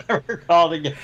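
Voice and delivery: words squeezed out through real laughter; helpless laugh around the words. Real, not acted. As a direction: crack up while saying the tag line. Laughing after own punchline.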